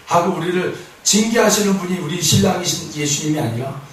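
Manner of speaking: ordinary talk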